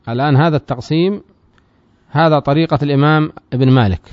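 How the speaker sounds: normal talking voice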